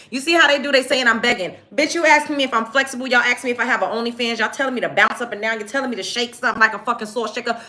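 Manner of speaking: fast, high-pitched indignant tirade; heated rant. Real, not acted. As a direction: rail at the hecklers in a rush. Rant at chat over begging accusation.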